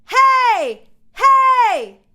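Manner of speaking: loud high shouts on a pitch; shouted hey. Shown as a demonstration. Coach shouting hey on the exercise note. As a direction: shout three times, full voice. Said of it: "We're going to shout "hey".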